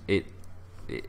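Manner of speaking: ordinary talk, nothing marked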